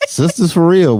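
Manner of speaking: excited. Real, not acted. Host joking about church sisters.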